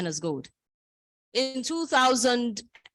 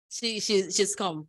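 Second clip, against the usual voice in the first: ordinary talk, nothing marked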